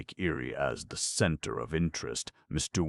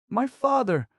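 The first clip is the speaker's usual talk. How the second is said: much higher, loud joyful cry; overjoyed cry; 7 dB louder than usual. A narrator's reading. Pinocchio learning his father waits nearby, crying out overjoyed. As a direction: burst out with delight at the news. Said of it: "cried Pinocchio, overjoyed".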